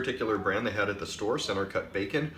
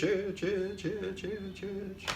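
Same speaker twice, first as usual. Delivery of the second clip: echo effect; 9 semitones higher, 4 dB quieter, much slower than usual. Shown as a demonstration. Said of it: "Sounds cooler that way".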